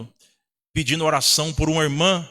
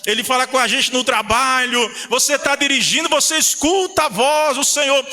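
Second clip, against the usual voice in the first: higher-pitched, driving preacher's delivery; pregação fervorosa; 5 semitones higher than usual. Real, not acted. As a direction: preach it with mounting fervour to the congregation. Preaching at full force. (pregação inflamada)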